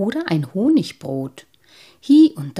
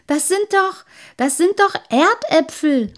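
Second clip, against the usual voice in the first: much higher and louder, laughing disbelief; two boys calling out to correct the sprite; exclaiming boys; 11 semitones higher, 8 dB louder than usual. A narrator's reading. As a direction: cry out that they're only potatoes. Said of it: "riefen die beiden anderen".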